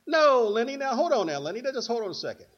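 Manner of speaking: high, loud mock protest; mock backtalk. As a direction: imitate a cheeky kid cutting off grandma. Imagines sassing his grandmother.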